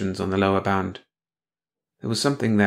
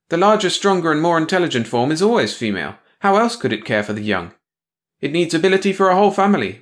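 higher, louder, know-it-all female alien; a condescending creature talking down to her mate; Patara's logic lecture; 7 semitones higher, 4 dB louder than usual. Acted. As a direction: lecture him on logic, sure and loud.

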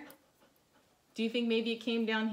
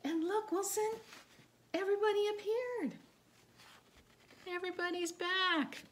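much higher happy exclamation; delighted; 11 semitones higher than usual. Acted. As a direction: cheer the good news to the dog. Delight everyone returned.

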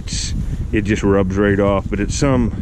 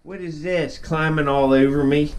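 pet talk; 6 semitones higher than usual. Real, not acted. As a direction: coo at the little pet, pitched up. Talking to his pet squirrel.

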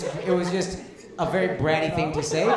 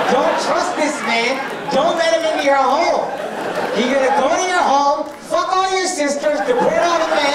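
higher and louder, hammed-up alarm; mock public warning; 8 semitones higher, 3 dB louder than usual. Comic warns audience women about this man, loud mock alarm. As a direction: warn the whole room like a town crier, half joking.

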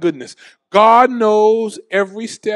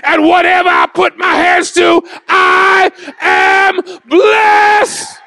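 shouted, higher sermon climax; full-force preaching; 12 semitones higher, 4 dB louder than usual. Acted. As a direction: preach it loud to the congregation.

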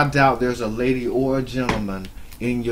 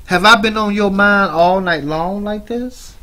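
mocking taunt; 8 semitones higher, 8 dB louder than usual. Real, not acted. Taunting a rival streamer.

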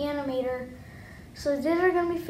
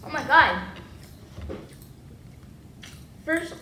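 mouth full. Real, not acted. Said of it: "I can barely talk with these things in my mouth".

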